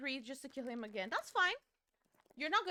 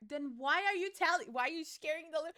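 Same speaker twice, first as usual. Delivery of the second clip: normal talking voice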